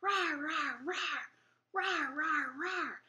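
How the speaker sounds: high, playful mock roar; dragon roar. Singer plays shouting dragon for babies, dragon roar. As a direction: roar like a small dragon without real volume. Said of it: "the dragon in the wagon shouts".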